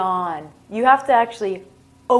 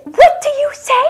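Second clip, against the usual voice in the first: sharp, high, loud scolding question; pitched way up in mock outrage; indignant demand; 9 dB louder than usual. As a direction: scold them like an offended stranger. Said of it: "People will become visibly angry".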